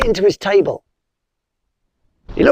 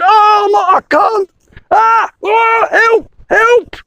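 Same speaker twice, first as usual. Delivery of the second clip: panicked spider; 14 semitones higher, slower than usual. Acted. Spider rolling in, squealing for help. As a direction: yelp for help like a tangled spider.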